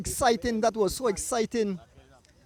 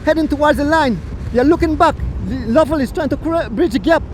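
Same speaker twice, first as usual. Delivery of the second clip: quick, raised play-by-play call; race commentary; 6 semitones higher, 8 dB louder than usual. Acted. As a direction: call the finish of the bike race live.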